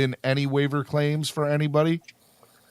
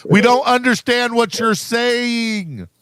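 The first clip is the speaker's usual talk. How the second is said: raised voice, stretching every word; exasperated shout; 9 semitones higher, 6 dB louder than usual. Real, not acted.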